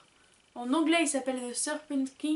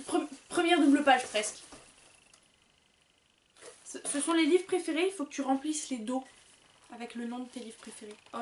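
usual speaking voice

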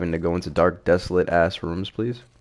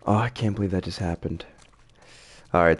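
normal talking voice

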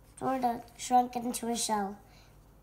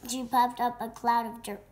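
plain reading voice

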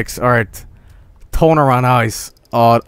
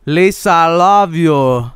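higher, slow anguished plea; Tommy Wiseau impression; 6 semitones higher than usual. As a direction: overwrought Room-style wail. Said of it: "looks like Tommy Wiseau now".

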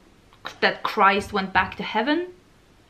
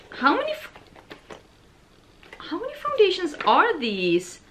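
higher, incredulous question; astonished disbelief; amazed; 8 semitones higher than usual. Real, not acted. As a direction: gasp at the amount. Counting many foundations.

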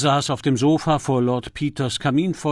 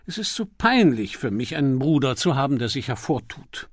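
ordinary delivery, nothing marked

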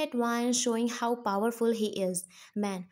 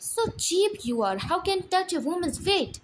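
fast, loud and higher furious rant; angry shout; 6 semitones higher, 3 dB louder than usual. Acted. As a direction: tear into your son in front of all. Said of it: "Dad shout".

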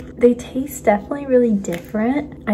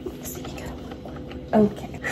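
hushed nervous whisper; 9 dB quieter than usual. Real, not acted. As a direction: whisper it under your breath. Hoping the coffee machine works.

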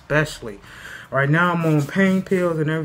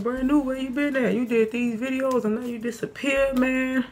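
questioning fans; 10 semitones higher than usual. Retold or imitated. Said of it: "I know y'all looking like".

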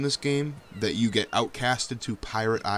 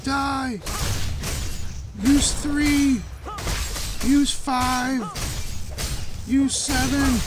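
much higher calls, keyed up; battle calls; 13 semitones higher, much slower than usual. Real, not acted. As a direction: bark commands mid-fight. Calling out moves in boss fight.

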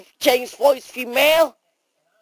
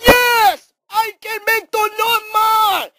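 high insistent shout; 9 semitones higher than usual. Acted. Character demanding an end to mouse voice, high shouting. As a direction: cry it out high and emphatic.

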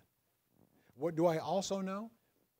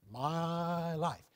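"My life" said slowly, with weight on "life"; stress on my life. Shown as a demonstration. Pastor showing the congregation where the stress falls. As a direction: lean on "life" so it carries the point. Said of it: "where was the emphasis?".

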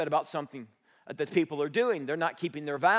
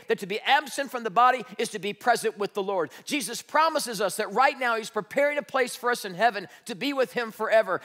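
ordinary talk

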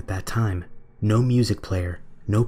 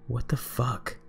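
muttered to himself; 3 semitones higher, 7 dB quieter, slower than usual. A narrator's reading. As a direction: mutter it under your breath. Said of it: "I muttered to myself".

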